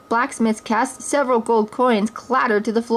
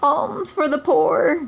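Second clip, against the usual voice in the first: slow, higher, wavering plea of a poor beggar; beggar's cry; 7 semitones higher, slower than usual. Acted. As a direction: beg passers-by for coins. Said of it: "cried a poor blind beggar man".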